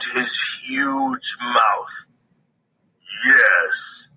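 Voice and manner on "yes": low bear growl. A narrator's reading. Bear growling at the pinned squirrel. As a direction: growl it like a big angry bear. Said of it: "Bear growled".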